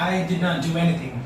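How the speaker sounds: ordinary talk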